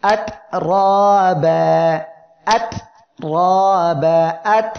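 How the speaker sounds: আত, র, বা spelled out slowly, each letter held firm; slow firm recitation. Shown as a demonstration. Teacher showing students slow, firm letters. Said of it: "আর একটা উদাহরণ দেখি".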